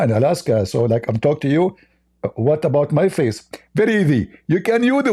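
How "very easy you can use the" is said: high, lisping caricature; Zakir Naik. Acted. Mock preacher solving the face problem, high and lisping. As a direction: explain in a silly high preacher voice. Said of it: "Hey, Zakir Naik".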